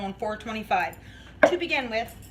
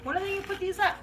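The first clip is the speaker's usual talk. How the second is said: higher, eager little whine; impatient; 9 semitones higher, much faster than usual. Retold or imitated. Waiting all year for the downtown lights, impatiently eager. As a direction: sound like a kid waiting for Christmas lights. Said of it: "I'm like".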